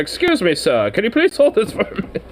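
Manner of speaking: a hint of a polite toff asking a favour; mock-refined stranger asking a passerby for help; polite posh request. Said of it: "He's like".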